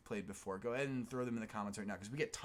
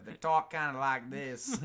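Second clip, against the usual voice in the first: mock Australian accent, slow and louder; 6 dB louder, slower than usual. Showing how Australians talk, in an Australian accent. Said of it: "that's how they talk in Australia".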